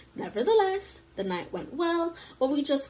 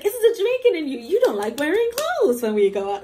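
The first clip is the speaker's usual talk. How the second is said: high, loud imitation of a friend; mock accusation; 4 semitones higher, 5 dB louder than usual. Friend teasing her about skimpy clothes, loud mock accusation. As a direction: call her out, higher and louder.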